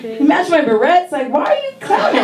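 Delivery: put-on high indignant kid voice. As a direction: protest the teasing with a laugh. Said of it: "I was like".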